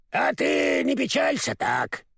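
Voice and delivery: slow hoarse croak; a hint of a husky crow. A narrator's reading. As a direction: rasp it out kindly. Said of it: "хрипло сказала она мне".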